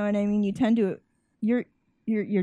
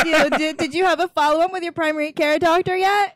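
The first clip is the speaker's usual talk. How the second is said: role-played check-up call; 7 semitones higher, 4 dB louder than usual. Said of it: "let's play it out".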